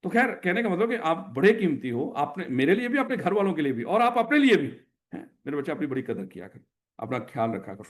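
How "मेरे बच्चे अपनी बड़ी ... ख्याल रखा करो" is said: tender advice. Real, not acted. Teacher advising students tenderly.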